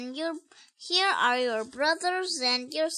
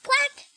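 high, bright quack; duck voice; 9 semitones higher than usual. Acted. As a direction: quack like a little duckling.

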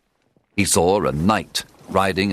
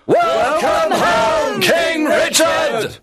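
high, slow shouted welcome; crowd cheering; 5 dB louder, much slower than usual. A narrator's reading. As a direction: cheer the returning king at full voice. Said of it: "cheered and cried".

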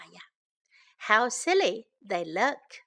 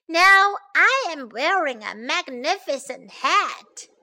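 higher, louder boast; proud; 5 semitones higher, 6 dB louder than usual. Acted. Animals admire their new hats, proudly boasting. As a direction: show off grandly, voice lifted.

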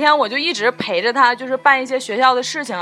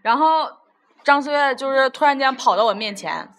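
ordinary delivery

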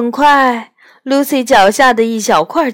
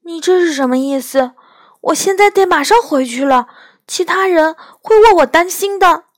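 rising, hurried and anxious; a bit frightened; 9 semitones higher, 5 dB louder, faster than usual. A narrator's reading. Lucy anxious to get home, fast and frightened. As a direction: rush the words as worry builds. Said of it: "感到有点害怕".

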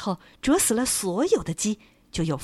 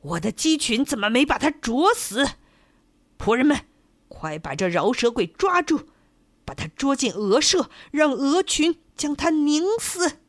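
angry shout; 5 semitones higher than usual. A narrator's reading. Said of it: "地主看见公鸡后叫道".

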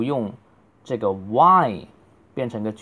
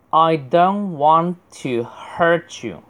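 slow, higher, every word separate; slow careful reading; 5 semitones higher, slower than usual. Shown as a demonstration. Teacher models the sentence before speeding up, slow and careful. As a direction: spell it out slowly for learners. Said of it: "如果读得快的话".

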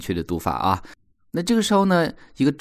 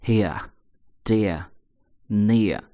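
British accent, no r, in dear and near; much slower than usual. Shown as a demonstration. Teacher imitates the British accent for contrast. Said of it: "英国人读的时候不卷舌头".

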